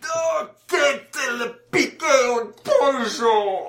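high, slow, hammed-up wail of pain; wailing injured man. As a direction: cry out in a high, put-on voice.

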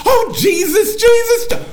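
Holy Ghost meltdown shriek, very high and loud; screaming. Retold or imitated. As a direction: imitate an overwhelmed woman crying out in praise. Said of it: "she's screaming".

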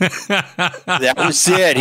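laughing through the words, drawn out. Real, not acted. Ballplayer recalling his comeback to a heckler, laughing. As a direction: chuckle it out slowly, pleased.

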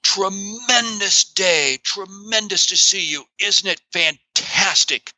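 booming, drawn-out enthusiastic greeting; high, slow and full of exuberance; Charlie. Retold or imitated. Ailing Charlie greets Mark at an event, exuberantly. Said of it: "And yet he goes".

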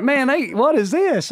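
high, baffled question. Retold or imitated. Young guide at vegetarian line, high baffled question. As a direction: ask it bewildered.

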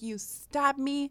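high, drawn-out mock scream without the volume; retold scream. Imitating a model accusing the hairstylist, mock screaming. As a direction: imitate an outraged shriek, held back. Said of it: "screaming at the top of her lungs".

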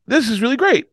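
high-pitched retold amazement; high voice of a moved woman; imitated overwhelmed winner. Raffle winner reacts, retold.

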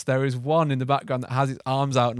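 normal talking voice

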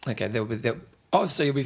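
ordinary delivery

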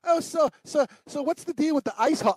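imitating a kid. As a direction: poke fun at the kid. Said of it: "same thing, he goes".

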